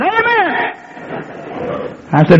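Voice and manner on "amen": high, soft imitated amen from the man; a thin, higher amen from the pew; mock amen. Churchgoer answering the preacher again, high soft amen.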